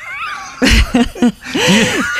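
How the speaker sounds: big surprised laugh; burst of laughter. Real, not acted.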